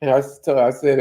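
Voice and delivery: ordinary delivery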